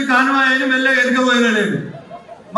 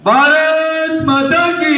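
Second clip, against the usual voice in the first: high, very drawn-out rally cry; slogan call; 4 semitones higher, 3 dB louder, much slower than usual. Real, not acted. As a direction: stretch it out for the crowd's response. Closing slogan call to crowd. (బాగా సాగదీసిన నినాదం పిలుపు)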